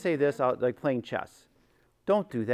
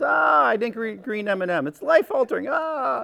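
higher, hammed-up wail of a child denied candy; lightly voiced distraught kid with a laugh; mock kid tantrum; 9 semitones higher, 4 dB louder than usual. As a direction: play a child melting down over a green M and M. Kid flipping lid over candy.

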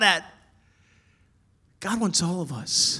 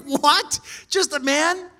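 higher and louder, incredulous; mock disbelief; 10 semitones higher, 5 dB louder, slower than usual. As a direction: act stunned at the news. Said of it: "And we're like".

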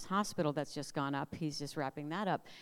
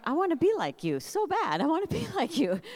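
gushing admiration; 10 semitones higher, 5 dB louder than usual. Retold or imitated. Gushing admiration for calm husband.

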